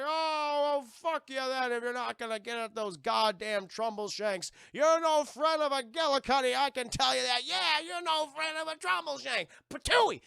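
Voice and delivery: high, loud outraged scolding ending in a spit; angry tree tirade. Acted. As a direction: chase them off with insults. Tree scolds departing kids.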